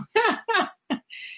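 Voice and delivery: a sudden high burst of laughter. Real, not acted. Guest laughing at the host's big question.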